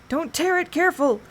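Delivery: high excited yell. A narrator's reading. Boy yells at the other over the handbill, high and excited. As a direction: shout to protect the paper.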